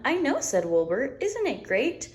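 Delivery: high, gleeful lamb voice; high excited Wilbur; delighted little lamb. Acted. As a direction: beam with pride, way up high. Wilbur catchphrase.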